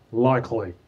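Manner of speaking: LIKELY with an effortless Aussie sound; Australian "Likely", K far back in the mouth; Australian accent. Shown as a demonstration. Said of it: "it just comes out like that".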